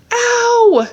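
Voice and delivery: put-on high, loud childish cry of pain; pained yelp. A narrator's reading. Boy tumbling down the hill yelps in pain. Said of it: "he groaned".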